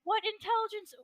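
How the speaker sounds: can't believe what he just said; incredulous. Real, not acted. Challenging the agencies claim, an incredulous outburst.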